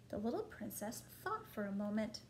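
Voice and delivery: ordinary delivery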